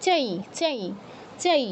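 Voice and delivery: "চাই" with the anusvara as a nasal i; nasal i sound. Shown as a demonstration. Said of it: "সাউন্ডটা হবে ই হসন্ত চন্দ্রবিন্দুর মতো".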